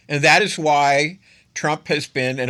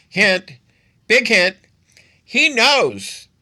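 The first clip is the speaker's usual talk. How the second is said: higher and louder on each hint; louder, escalating hint; 8 semitones higher, 6 dB louder, much slower than usual. Real, not acted. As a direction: build the "big hint" to a near shout.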